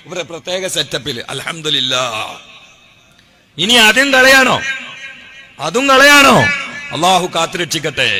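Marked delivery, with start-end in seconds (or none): 3.6-6.4 high, loud demanding question to the crowd; rising indignant cry. Real, not acted. Preacher to congregation, challenging the critics, loud indignant cry. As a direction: hurl the question at the critics, loudly.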